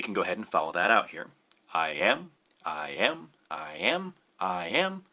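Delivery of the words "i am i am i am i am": unstressed "I", stressed "am", each pair lower-pitched; measured "I am" pulse, second syllable rising; iambic stress. Shown as a demonstration. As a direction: tap out "I am" with the weight on "am". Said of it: "an unstressed syllable followed by a stressed syllable".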